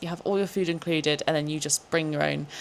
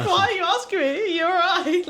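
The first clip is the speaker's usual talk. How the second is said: much higher laughing quote, nearly crying with laughter; laughing mock confusion; 13 semitones higher, 4 dB louder than usual. Retelling the housemate's confusion, in fits of laughter. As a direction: squeal it out between laughs.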